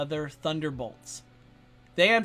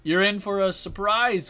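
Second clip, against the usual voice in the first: loud theatrical call-out; loud mock challenge; 7 dB louder than usual. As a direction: proclaim it like a hero. Mock address to villain.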